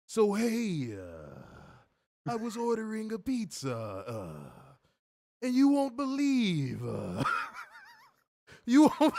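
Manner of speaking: high voice cracking into laughter, Triple L slowed right down; Triple L impression. For a chat who requested it, the Triple L voice, high and slow. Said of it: "I quite like the Triple L impressions".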